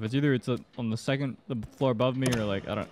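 usual speaking voice

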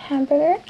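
English "hamburger"; English word. Shown as a demonstration. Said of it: "es casi igual en español".

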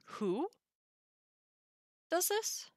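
"Who does this?" with pitch climbing high at the end; questioning inflection. Shown as a demonstration. Teacher demonstrates question inflection for students, "Who does this?" rising sharply. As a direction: lift "Who" and send "this" right up. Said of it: "we use a certain inflection in our voice".

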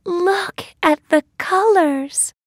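very high, wondering heroine voice; delighted cry. A narrator's reading. Thumbelina seeing the world from the sky, high delighted cry. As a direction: marvel at it high up in your range. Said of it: "cries Thumbelina".

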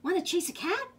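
high, eager storybook dog question; dog's invitation. A narrator's reading. Dog inviting the spider to play, high voice. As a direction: pitch it up like a little dog. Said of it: "barked the dog".

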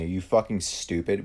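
ordinary talk

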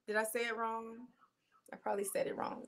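under the breath, almost voiceless; whispered aside. Real, not acted. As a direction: say it to yourself, barely voiced. Unsure muttered self-correction.

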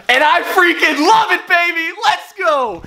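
loud, high, bursting with energy; sudden hype. Acted. Hyping up his fans out of nowhere, loud and excited. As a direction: flip into a pumped-up cheer.

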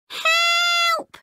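very high, panicky robot yelp; tiny robot crying out in fear; robot cry for help. Acted. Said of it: "shouts Clunk".

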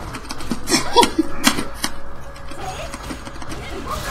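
high, airy wheeze of a laugh; breathless laugh. Real, not acted. Laughing at the combo.

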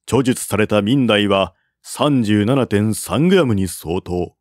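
ordinary delivery

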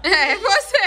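high, loud teasing through laughter; loud, giggly finger-pointing; laughing agreement. Real, not acted. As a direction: laugh as you pick on him. Teasingly volunteering the father.

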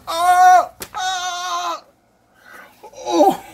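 high, loud squeals breaking into a laugh; excited squeals. Real, not acted. Seeing his dream knife for viewers, squealing high with joy. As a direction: shriek with pure joy.

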